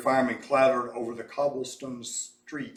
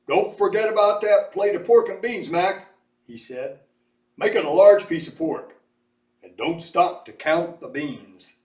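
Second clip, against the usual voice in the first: loud, hearty big-man voice; hearty Mose; 4 semitones higher, 10 dB louder than usual. A narrator's reading. Mose orders his pork and beans from Mac, hearty loud voice. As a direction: boom it out cheerfully.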